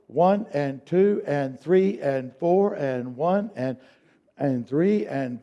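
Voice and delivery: slow, even count. Shown as a demonstration. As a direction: count it out slowly. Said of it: "now speed up".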